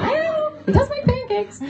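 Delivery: high, light mock reply; playfully high answer defending Julian; mock defensive quip. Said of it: "And I was like".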